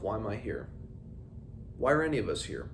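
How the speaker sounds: usual speaking voice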